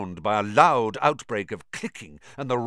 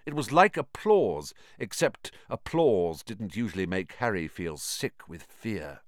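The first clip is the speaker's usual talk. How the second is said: ordinary delivery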